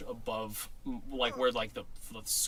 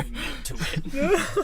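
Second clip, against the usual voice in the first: high, slow mock interrogator demand; hammed-up mock cop pressing a kid; 7 dB louder, much slower than usual. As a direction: squeeze the confession out, high and drawn out.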